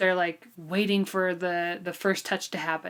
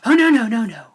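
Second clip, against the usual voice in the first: mock refusal; 3 semitones higher, 10 dB louder than usual. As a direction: shut it down with hammed-up alarm. Quoting refusal to discuss early.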